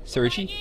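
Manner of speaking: SERGEY with a soft g, "SUR-jee"; garbled pronunciation guess. Shown as a demonstration. Guessing at a target's name, Sergey as "sur-jee"?. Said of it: "Is that how I say it?".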